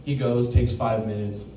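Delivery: ordinary talk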